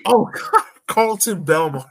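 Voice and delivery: ordinary delivery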